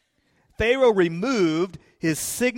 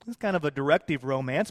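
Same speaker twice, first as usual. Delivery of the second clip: usual speaking voice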